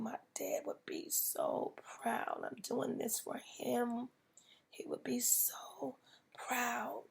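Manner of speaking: soft, slow, half-whispered through tears; tearful whisper. Retold or imitated. Telling husband about late dad, tearful whisper. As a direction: say it as if the tears are coming. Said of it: "I just started crying".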